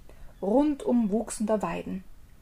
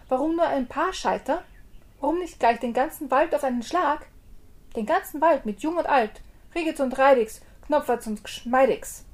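higher, swaggering brag; boasting; 5 semitones higher, 4 dB louder than usual. Acted. Tailor brags to the giant, higher swagger. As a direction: show off shamelessly. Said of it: "fing gleich wieder an zu prahlen".

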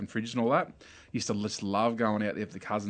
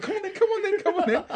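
very high, louder, rushed come on then; excited urging; 6 dB louder than usual. Retold or imitated. Cousin runs off with stolen Coke, urging him on in a very high, excited squeal. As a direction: squeal at him to hurry up and run.

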